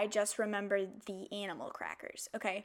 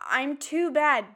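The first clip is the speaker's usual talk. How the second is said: defiant; 3 semitones higher, 8 dB louder, much slower than usual. Real, not acted. Defiant grocery store call.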